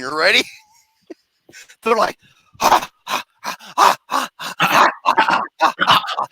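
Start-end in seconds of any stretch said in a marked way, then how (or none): 2.6-6.3 breathy imitated cult chant, ha ha; mock the dancers' rhythmic noises. Imitating the dancing cult members' rhythmic breathy chant. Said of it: "This is the noises they were making".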